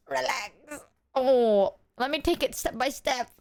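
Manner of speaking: normal talking voice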